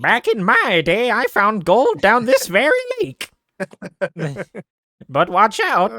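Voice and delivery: put-on high, loud old prospector voice; Prospector Cade. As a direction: crow it out like an old gold miner. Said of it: "I could pretend to be, like, an old prospector".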